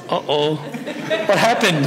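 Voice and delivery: slow, laughing mock alarm; half-laughing anxious reaction; laughing nervous uh-oh. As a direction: chuckle through a nervous what happened. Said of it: "I'm like".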